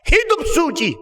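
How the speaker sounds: acted shout of a prophet; crying out. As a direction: call out urgently to the crowd. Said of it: "Hamba Tuhan yang berteriak".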